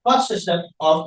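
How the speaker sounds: measured, low-pitched dictation with long pauses. Shown as a demonstration. Teacher giving students a heading to write, dictating. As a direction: dictate phrase by phrase, pitched low, pausing between. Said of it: "लिखिए".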